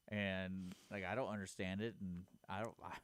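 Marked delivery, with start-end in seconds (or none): none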